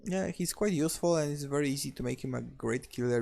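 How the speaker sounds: usual speaking voice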